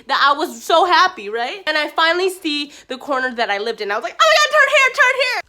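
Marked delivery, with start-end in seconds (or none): 4.2-5.4 high, loud excited call; hammed-up frantic directions; urgent excitement. Retold urgent direction to driver.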